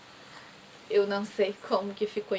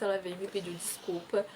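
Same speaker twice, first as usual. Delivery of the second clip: ordinary delivery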